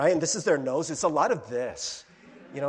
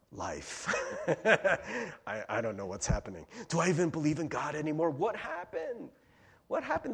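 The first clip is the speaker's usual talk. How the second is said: deflated despair; 2 semitones lower, 5 dB quieter than usual. Retold or imitated. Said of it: "later, you're like".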